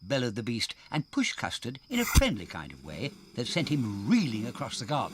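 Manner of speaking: lumbering friendly pit elephant from the north; northern elephant. Acted.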